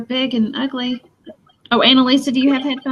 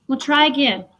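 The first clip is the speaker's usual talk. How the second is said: normal talking voice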